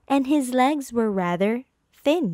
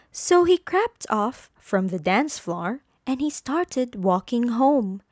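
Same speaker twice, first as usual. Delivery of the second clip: ordinary delivery, nothing marked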